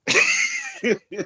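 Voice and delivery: squealing laugh with a falling end; laughing hard; high burst of laughter. Real, not acted. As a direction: cut loose in a high laugh. Laughing at own joke.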